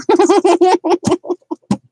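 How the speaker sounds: bright burst of laughter; amused real laugh; high giggle. Real, not acted. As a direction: laugh at yourself. Laughs at own impression.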